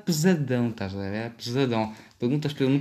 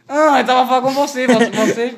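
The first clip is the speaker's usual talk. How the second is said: laughing; 9 semitones higher, 7 dB louder than usual. Real, not acted. Teasing friend, laughing accusation.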